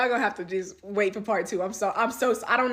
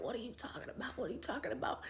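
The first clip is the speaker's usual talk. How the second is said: much softer, playing dumb; mock innocent; 15 dB quieter, faster than usual. Mimicking the boyfriend's denial, soft and meek. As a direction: mumble a fake denial.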